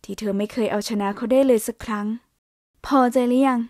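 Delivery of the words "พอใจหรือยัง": slow, biting sarcasm; sarcastic. A narrator's reading. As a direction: throw it back at him sarcastically. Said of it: "อย่างประชดประชัน".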